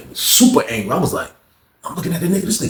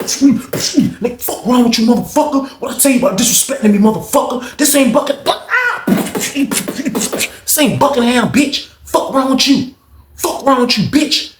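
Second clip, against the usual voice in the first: furious yelling; 11 semitones higher, slower than usual. Retold or imitated. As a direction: snarl the threat in a fury. Lawrence attacking BB.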